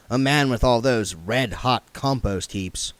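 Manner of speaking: ordinary delivery, nothing marked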